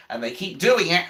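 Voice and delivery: ordinary delivery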